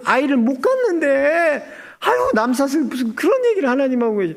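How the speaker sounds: high-pitched, incredulous woman protesting; Sarah. Retold or imitated. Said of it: "듣고 있던 사라가 어떻게 되냐면". (기가 막힌 듯 높은 목소리로 흉내)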